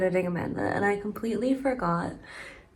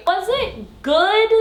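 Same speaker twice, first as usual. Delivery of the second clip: playful rhetorical question; 12 semitones higher, 5 dB louder, slower than usual. Real, not acted. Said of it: "asks viewers, rhetorical".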